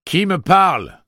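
loud, high, challenging shout. Acted. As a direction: call out to an unseen voice. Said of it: "cria-t-il".

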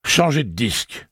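a voiced flash of anger; real anger. A narrator's reading. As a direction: snap it at him, angry. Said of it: "sa colère n'était pas feinte".

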